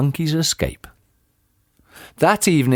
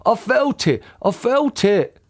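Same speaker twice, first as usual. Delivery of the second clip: raised pitch, insistent; character's shout; 9 semitones higher than usual. A narrator's reading. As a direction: insist in a high, contained shout. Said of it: "shouted Mister Twit".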